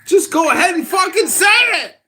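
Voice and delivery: high, full-force shout; exasperated screaming demand; angry shouting. Real, not acted. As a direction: yell the demand, drawing out the last words. Yelled demand to say it.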